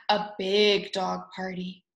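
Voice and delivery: plain reading voice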